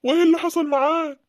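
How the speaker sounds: eager question. Acted. Eager father questions son.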